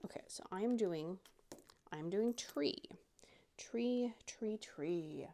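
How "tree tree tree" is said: whisper. Real, not acted.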